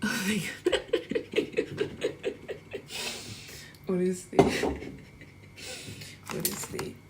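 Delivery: real helpless laughter; laughing to tears. Real, not acted. Streamer cracking up in front of viewers, laughing to tears. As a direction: laugh through the words, barely getting them out. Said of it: "I'm actually crying".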